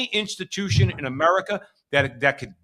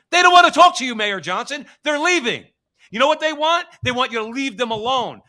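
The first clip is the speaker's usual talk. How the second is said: much higher and louder, yelling at the mayor; angry shout; 6 semitones higher, 7 dB louder than usual. Real, not acted. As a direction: let it rip at Mayor Johnson.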